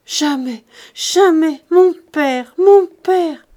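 loud, high wail building in grief; anguished sobbing cry. Acted. Charles wailing to his uncle over his dead father. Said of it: "Les pleurs et les sanglots".

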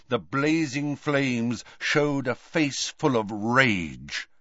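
ordinary delivery, nothing marked

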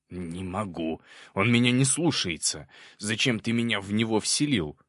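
complaining demon in mild panic; panicked demon. A narrator's reading. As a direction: wail helplessly at the summoner. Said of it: "в панике заорал Проныра".

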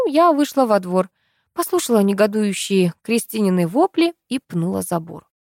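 plain reading voice

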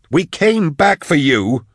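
high, slow, angry retort; snarling. A narrator's reading. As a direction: snarl it with drawn-out reproach. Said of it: "Drusha snarled".

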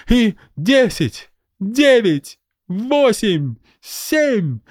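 high kid in a mock rescue; playacting child. A narrator's reading. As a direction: shout the countdown like a game. Child playing resuscitation.

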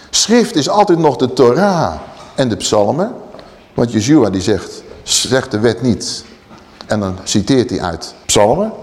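normal talking voice

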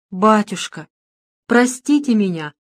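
understated anguished plea; moaning plea. A narrator's reading. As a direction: beg the priest for forgiveness. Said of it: "вдруг застонал Луготинцев". (с мольбой, как стон)

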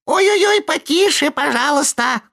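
very high, thin squeak; squeaky tiny voice. A narrator's reading. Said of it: "необыкновенно тоненький голосок пропищал".

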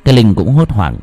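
horror story narration. A narrator's reading. Narrator telling listeners the creepy scene, in a dark storytelling voice. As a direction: read it like a spooky tale by night.

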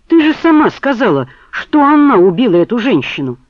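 high, loud threatening outburst; furious. A narrator's reading. He argues, outraged.

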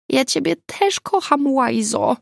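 high squeaky affectionate line; squeaking. Acted. Zuza squeaking into Agata's hoodie sleeve, high. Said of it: "pisnęła Zuza".

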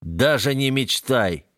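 put-on slow, slurring drunk warning; tongue tangling, drunk; slurred drunk voice. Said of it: "заплетающимся голосом предупреждаю я".

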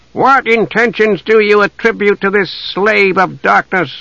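high-pitched hostile challenge; demanding. A narrator's reading. Elf warrior challenges the envoy about the knight, demanding. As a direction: demand an answer scornfully. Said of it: "one of the elves demanded".

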